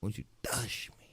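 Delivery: breathy whispered come-on; a mock seductive whisper to the co-host. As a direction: whisper it close and creepy. Whispered repeat of the song line.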